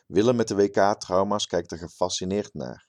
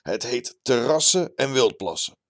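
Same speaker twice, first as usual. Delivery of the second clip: higher, louder show-off character voice; pleased-with-himself bragging man, pitched up; boastful terrace biologist; 6 semitones higher, 4 dB louder than usual. A narrator's reading. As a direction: brag like a puffed-up local celebrity. Character boasting about his TV show.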